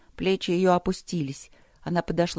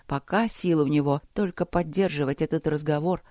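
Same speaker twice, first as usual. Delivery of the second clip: usual speaking voice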